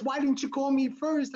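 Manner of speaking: mad wife. Retold or imitated. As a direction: retell the wife's angry reproach. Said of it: "She was so mad".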